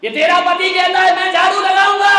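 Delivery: loud, high complaint; complaining shout. Retold or imitated. Mother-in-law shouts her complaint about her son to the daughter-in-law.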